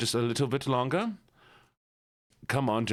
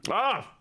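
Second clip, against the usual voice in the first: sharp sour gasp; 9 semitones higher than usual. Real, not acted. Bites the lemon peel.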